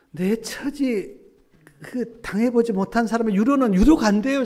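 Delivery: emphatic preaching. Real, not acted. Preacher insisting to the congregation that people can't comfort. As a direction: press it home with force.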